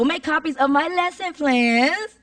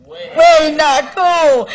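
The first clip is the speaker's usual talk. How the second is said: loud, higher, stretched-out WAY; drawn-out catchphrase; 4 semitones higher, 10 dB louder, much slower than usual. Shown as a demonstration. Speaker rallies teachers with the catchphrase, loud and drawn out. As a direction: belt it out slowly, milking every word.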